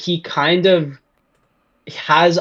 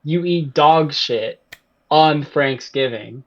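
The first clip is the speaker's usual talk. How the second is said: ordinary talk, nothing marked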